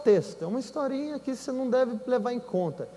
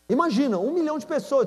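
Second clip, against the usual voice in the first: ordinary delivery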